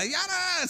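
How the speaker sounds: high imitated war cry; imitated chant. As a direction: whoop out a made-up chant, high-pitched.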